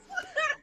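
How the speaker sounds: short high burst of laughter; real high laugh. Real, not acted. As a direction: squeak out a laugh. Laughing at own story.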